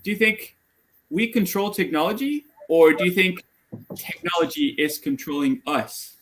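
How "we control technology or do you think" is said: ordinary talk, nothing marked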